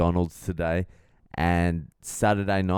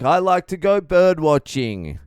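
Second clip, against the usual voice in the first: high, loud over-eager voice; booming bird-watcher; loud guy; 9 semitones higher, 8 dB louder than usual. Retold or imitated. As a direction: blurt it out too loud. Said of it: "He's like".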